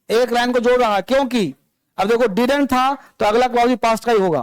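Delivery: ordinary delivery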